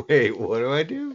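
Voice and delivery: high and confused, laughing; giggly lost stoner; stoned. Real, not acted. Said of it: "Dude, I'm so high".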